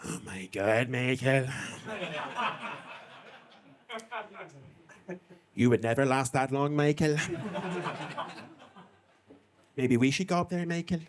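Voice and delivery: a hint of a wandering Irish accent teasing Michael. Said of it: "That accent took a bit of a European tour".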